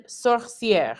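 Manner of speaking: "sorcière" with è as open "eh"; French pronunciation. Shown as a demonstration. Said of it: "word that uses an accent grave".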